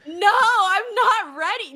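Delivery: mock despair. Real, not acted. Refusing to accept Rey Mysterio's retirement, pleading whine. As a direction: wail it like a fan refusing bad news. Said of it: "I'm gonna cry".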